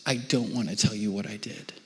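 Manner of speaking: put-on soft, tearful child voice; mildly imitated child in tears. Daughter confessing from her bunk, softly tearful. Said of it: "And she's crying, big tears".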